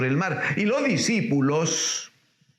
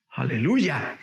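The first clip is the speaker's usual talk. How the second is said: ordinary talk, nothing marked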